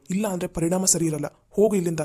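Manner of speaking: rising to a loud furious order; angry shout. Acted. Angry boss throwing Netra out of his office, shouting. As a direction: bark her out of the room.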